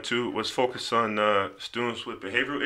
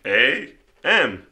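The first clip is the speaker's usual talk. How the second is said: confident spelling; 4 semitones higher, 7 dB louder, much slower than usual. Retold or imitated. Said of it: "I was like".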